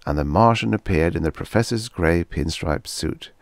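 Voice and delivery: ordinary delivery, nothing marked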